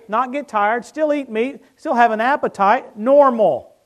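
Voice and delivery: loud, high preacher's drive; full-force preaching. Acted. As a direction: preach it with fire.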